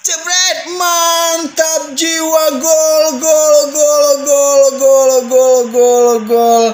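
loud, high repeated goal call; touchdown call. Real, not acted. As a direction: celebrate the goal, building then easing. Goal scored, hat-trick.

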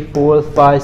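usual speaking voice

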